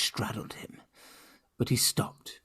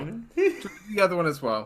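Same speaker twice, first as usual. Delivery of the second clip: repeated with laugh; 7 semitones higher, faster than usual. Real, not acted.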